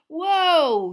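loud, high whoa to halt a beast; sudden high cry of whoa; startled shout. Acted. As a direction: yank the reins and yell it. Pulling hippogriff to a stop.

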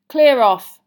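sharp, loud dismissal; snapped. A narrator's reading. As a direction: snap it loudly to send them away. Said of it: "Ron snapped at them".

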